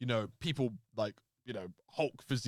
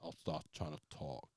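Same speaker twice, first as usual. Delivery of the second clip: low, soft, grudging reply; subdued low mock wrestler voice; imitating reluctant wrestler; 11 semitones lower, 11 dB quieter than usual. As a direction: answer quietly, deep down in the register. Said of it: "he was like".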